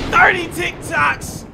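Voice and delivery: slow loud announcer call; hype title read. Real, not acted. Opening the video to viewers, hyped slow announcer call. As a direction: boom it out like a title card. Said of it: "reads the title".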